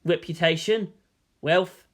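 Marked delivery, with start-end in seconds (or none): none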